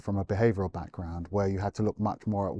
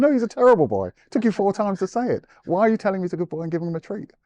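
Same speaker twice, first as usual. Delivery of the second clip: much higher, louder, incredulous scolding, fast at the end; indignant retort; 11 semitones higher, 6 dB louder, faster than usual. Retold or imitated. Trainer to owner about the treat, an incredulous high scolding. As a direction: act out telling the owner off in disbelief.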